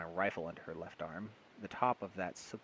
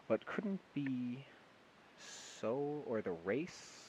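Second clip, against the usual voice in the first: slow puzzled misreading; 4 semitones higher, much slower than usual. Real, not acted.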